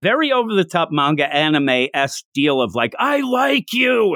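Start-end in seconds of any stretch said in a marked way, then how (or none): 3.0-4.1 high, drawn-out blurted confession; mock confession scream. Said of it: "and she's screaming".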